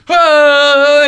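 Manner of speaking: long high hoot, very loud; flying "oh" howl. Retold or imitated. Host imagining the man flying like a bird, loud sustained hoot. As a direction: wail one held note like a flying creature. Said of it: "he goes".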